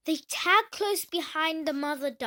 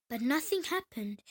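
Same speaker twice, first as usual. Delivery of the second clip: ordinary delivery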